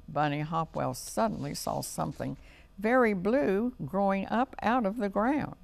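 ordinary delivery, nothing marked

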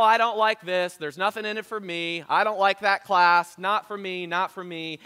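loud, high whiny grumbling; mock a self-centered member; hammed-up complaining; selfish churchgoer.

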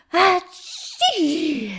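loud, drawn-out sneeze. Acted. Bookworm sneezing in the cold morning air, loud sneeze. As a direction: let a big slow sneeze burst out. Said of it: "niesen musste".

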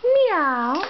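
high rising meow; a playful kitten meow for a toddler; cat meow. Shown as a demonstration. Modelling animal sounds for toddler.

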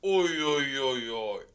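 contented overfed groaning; groaning full belly. Acted. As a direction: moan as if too full to move. Groaning from overeating.

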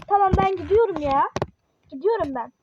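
loud, exasperated threat to leave; huffy and at the end of patience; fed up. Real, not acted. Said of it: "Sinirlenmekten bıktım artık ya".

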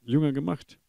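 loud, driving sermon delivery; full-force preaching. Acted. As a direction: preach hard to the church family.

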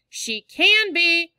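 slow high yell at brother. A narrator's reading. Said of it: "I yelled".

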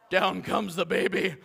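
lightly voiced eerie lullaby line; a hint of creepy singsong. Shown as a demonstration. Pastor to congregation, lightly creepy lullaby. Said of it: "just put a creepy voice to that whole thing".

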